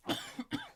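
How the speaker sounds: rough dry cough; coughing fit. Real, not acted. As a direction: cough hard, choking. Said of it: "Getting all choked up".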